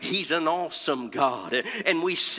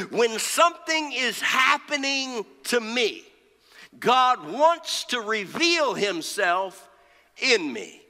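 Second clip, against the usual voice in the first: high opening, falling to a hushed close; emphatic preaching; 7 semitones higher, slower than usual. Real, not acted. Preacher giving the congregation the key point, emphatic preaching. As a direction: drive the point home with long pauses.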